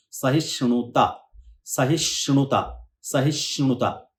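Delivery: सहिष्णुता slow, small pause after सहिष्ण, then णुता together; slow, slight break. Shown as a demonstration. Teacher drilling the word with a slight mid pause. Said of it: "सहिष्ण के बाद तोड़ना है".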